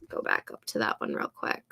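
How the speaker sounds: soft unvoiced mutter to herself; whispered aside. Real, not acted. Streamer scrolling back to a comment, whispered.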